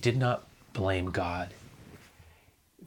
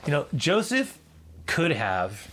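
usual speaking voice

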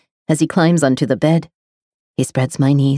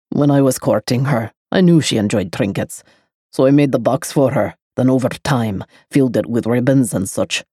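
man's voice, lower, reminiscing; low male voice; 5 semitones lower than usual. A narrator's reading. The lover telling of his bride, low. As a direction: remember her, lower.

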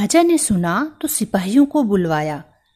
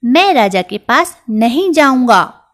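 stubborn mouse; 4 semitones higher, 8 dB louder than usual. Acted. Mouse refuses to go.

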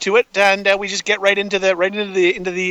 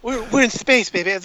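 badly faked wrestling-star voice, starting higher; hammy wrestler riff, speeding up at the end; terrible wrestler impression; 3 semitones higher than usual. Wrestling fan riffing on space for a co-host, bad impression. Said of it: "That's a terrible fucking impression".

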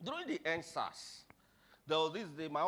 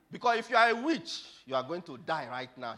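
loud warning that starts high and drops; acted menacing ultimatum; loud threat; 3 semitones higher, 12 dB louder than usual. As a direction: threaten him at full voice. Papa threatens the man.

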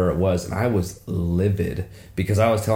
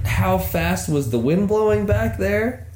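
slow, much higher, drawn-out puzzled repeat of the cop; baffled cop quote; 9 semitones higher, slower than usual. Retold or imitated. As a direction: replay the cop's line slowly as if still baffled. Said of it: "I had never heard this".

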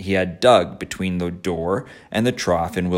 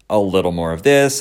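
usual speaking voice

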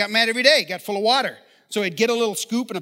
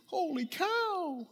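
mock shock; 8 semitones higher, 11 dB quieter, much slower than usual. Imagined viewer seeing Netflix stats.

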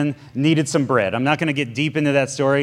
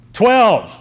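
higher, louder forced answer; louder repeat; 7 semitones higher, 6 dB louder than usual. Acted. Disciples repeating to Jesus after "What?", higher and louder. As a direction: say it up, as ordered.

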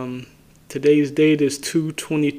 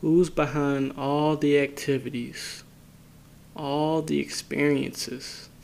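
unhurried phrases, each left to settle in silence; guided meditation. Acted. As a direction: guide listeners inward calmly, leaving space after each phrase.

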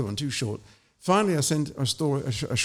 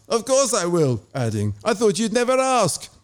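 warm; 7 semitones higher, 4 dB louder than usual. Acted. Renzo smiling accepts invitation.

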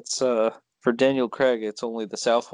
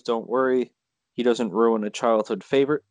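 ordinary talk

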